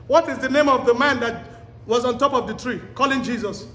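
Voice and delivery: usual speaking voice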